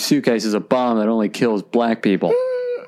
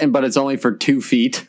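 ordinary talk

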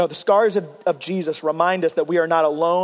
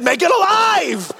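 much higher and louder, slow; desperate shout; 10 semitones higher, 4 dB louder, slower than usual. Retold or imitated. Husband to wife about her dead phone, desperate shout. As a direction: demand it in panic.

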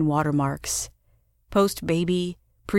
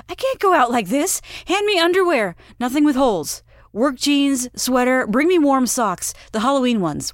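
much higher, louder rush of demands; frantic orders; 7 semitones higher, 4 dB louder than usual. A narrator's reading. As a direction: bark out orders in a panic. Wife frantically demanding clothes.

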